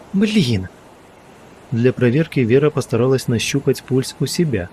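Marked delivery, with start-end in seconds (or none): 0.1-0.7 shrill rising panic on Блин; higher panicked curse. Acted. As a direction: cry out Блин sharply, high and scared. Panicked swearing, second louder.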